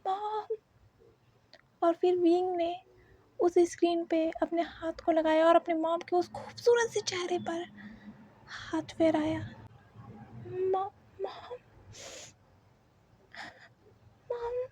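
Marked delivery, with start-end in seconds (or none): 0.1-0.6 soft tearful whisper. A narrator's reading. Wing sees his mother on screen. 10.5-14.7 high, slow, soft tearful calling; crying softly. A narrator's reading. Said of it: "आंखों में अचानक से ढेर सारे आंसू".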